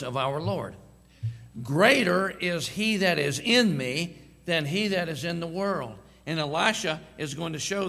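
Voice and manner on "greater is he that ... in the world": louder, slower preaching of the verse; preached scripture. Acted. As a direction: proclaim it to the church with weight. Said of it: "help me, church".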